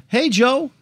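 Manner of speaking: imitating a friendly Arkansas lawyer's hello, half-hearted; Arkansas accent. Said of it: "with an Arkansas accent that I can't do".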